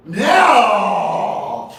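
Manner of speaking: loud, high, drawn-out cry; hammed-up howl of disbelief; mock outraged shout. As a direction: shout it out long in exaggerated protest. Mock outrage at audience member.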